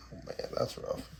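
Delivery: ordinary delivery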